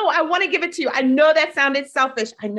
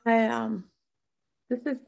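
usual speaking voice

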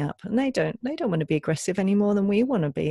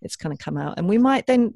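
ordinary delivery

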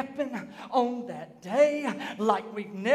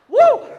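loud high whoop of joy; exultant high whoop; joyful whoop; 11 semitones higher, 12 dB louder than usual. Real, not acted. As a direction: let out a happy woo. Preacher cheering resurrection scene.

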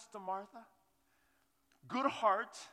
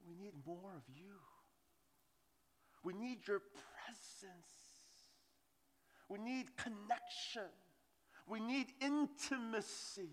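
soft heartfelt plea; 7 dB quieter than usual. Retold or imitated. Daughter's unspoken plea to her father, soft and hushed. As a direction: plead gently, almost whispering. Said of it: "what I think she was saying".

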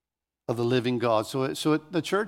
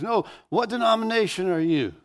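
higher, insistent retold repeat of the question; insistent inmate; 6 semitones higher than usual. Inmate brushing off the preacher's answer, insistent higher-pitched question. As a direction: press the question as the unsatisfied inmate. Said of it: "And he goes".

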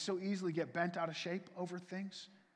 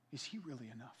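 much softer, lower challenge; hushed question; 5 semitones lower, 11 dB quieter, faster than usual. Real, not acted. Preacher pressing doubters, hushed and earnest. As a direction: ask it almost under your breath.

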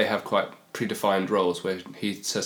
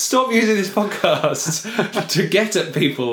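high, drawn-out playful scolding; mock telling-off; 8 semitones higher, 4 dB louder, slower than usual. Co-host teasing partner over a grudge, mock scolding. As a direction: pretend to be scandalised, pitched up and slow.

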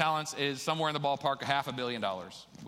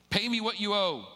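raised, higher-pitched demand for payment; angry demand; 7 semitones higher, 4 dB louder than usual. Acted. Servant grabs his debtor by the throat, angry demand. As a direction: demand it forcefully, acted out from the parable.

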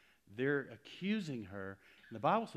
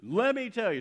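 louder, higher insistence; eager rebuttal; 7 semitones higher, 11 dB louder than usual. Retold or imitated. New believer eager to straighten out his friend, loud. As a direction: jump in to correct him. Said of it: "I was like".

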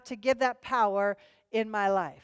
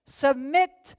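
louder, higher, driving sermon delivery; full-force preaching; 4 semitones higher, 5 dB louder than usual. Acted. Pastor urging the congregation to follow the Spirit, full-force preaching. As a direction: drive every line home to the congregation.

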